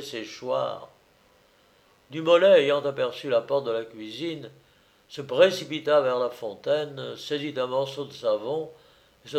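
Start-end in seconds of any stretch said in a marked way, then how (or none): none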